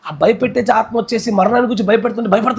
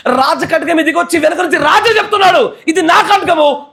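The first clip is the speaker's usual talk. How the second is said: fervent shout; 7 semitones higher than usual. Real, not acted. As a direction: proclaim at full voice, rising pitch. Preacher shouting, laughing. (బిగ్గరగా అరుస్తూ ప్రకటించడం)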